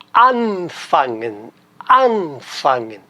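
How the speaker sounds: anfangen said slowly, long stressed AN. Shown as a demonstration. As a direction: stretch the AN of anfangen, leave fangen lighter. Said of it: "a sílaba tônica tá no an".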